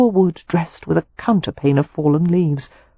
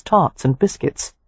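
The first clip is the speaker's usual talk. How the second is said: usual speaking voice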